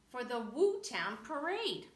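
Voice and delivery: ordinary delivery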